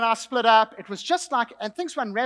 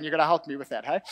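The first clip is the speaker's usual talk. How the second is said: ordinary delivery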